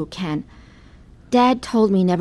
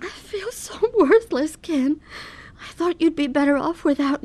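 tearful despair; 7 semitones higher than usual. Acted. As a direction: let the worthlessness crack the voice. Confessing despair to husband.

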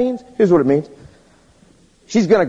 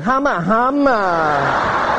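lustful humming; much slower than usual. Retold or imitated. Different humma humma.

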